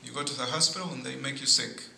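normal talking voice

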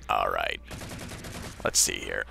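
a low, gruff soldier voice, lightly done; put-on low voice. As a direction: drop into a low hero voice. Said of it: "I don't even know why I'm talking like this".

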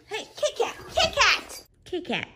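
very high pet-calling voice; high little coaxing call to a pet; calling the cat. Real, not acted. Getting Kit Kat's attention, high pet-calling.

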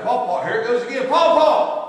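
put-on high, loud child's call of Paw Paw. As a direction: shout Paw Paw like an excited toddler. Child calling out grandpa.

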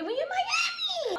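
high, drawn-out celebratory call; excited cheer. Retold or imitated. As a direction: sing it out, stretched and high. Excited about the Miami trip.

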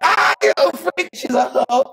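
high, loud mock-scandalised squeal; mock shocked shriek. Real, not acted. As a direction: shriek it out like you caught her. Teasing shriek at her confession.

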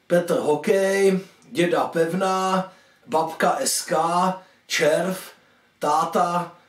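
ordinary delivery

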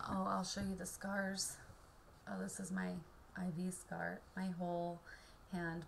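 usual speaking voice

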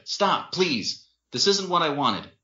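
high, distressed appeal; shouted plea. A narrator's reading. Man upset by the fight, pleading. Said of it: "Winston shouted".

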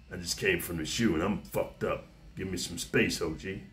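hushed, haunted ex-con asking for space; fresh out of solitary. Shown as a demonstration. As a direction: keep it low and far away, like he's still hearing voices in the hole. Demo of how to talk.

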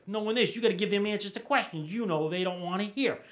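ordinary talk

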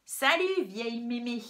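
brash mock hail; rude loud greeting. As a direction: fling it out cheekily. Granny imitates the rude girl.

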